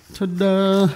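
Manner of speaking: high, held-out magician's flourish; high sustained stage fanfare; showy ta-da. Pretend. Magician's flourish while writing.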